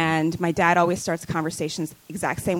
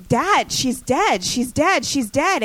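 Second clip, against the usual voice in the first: mildly acted distress, slow and high; retold crying plea; 7 semitones higher, slower than usual. Daughter pleads with unmoved dad over sister's death, retold crying. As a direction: plead in a raised pitch, imitating tearful panic. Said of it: "hyperventilating, crying".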